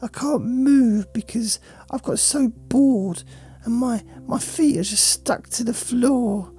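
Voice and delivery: sulky, bored dog complaining about its owner; a neglected pet moaning, half whisper at times. Acted. Voicing the dog's thoughts.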